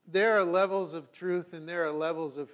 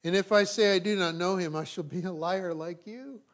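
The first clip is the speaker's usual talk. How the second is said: raised, declaiming Bible reading; scripture reading; 3 semitones higher, 4 dB louder than usual. Acted. Pastor reading John aloud to the church, declaiming. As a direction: proclaim the text to the congregation.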